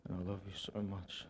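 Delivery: usual speaking voice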